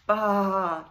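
loud lamb's baa carried on the wind; distant bleat. A narrator's reading. Shepherd hears his lost lamb bleating far off. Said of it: "he heard in the distance".

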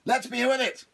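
high, hurrying devil voice; impatient devil. Acted. Devil hopping, urging Patrick to hurry. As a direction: hurry him along.

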